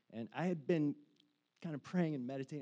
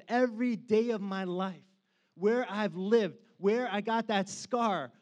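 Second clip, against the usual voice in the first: rising, louder passionate preaching; fervent preaching; 7 semitones higher, 9 dB louder than usual. Acted. Preacher stirring the congregation, passionate and loud. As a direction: build the praise up to full force.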